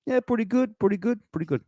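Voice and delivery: high-pitched, hammed-up Italian-American accent. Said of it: "do the rest of these in Italian accent".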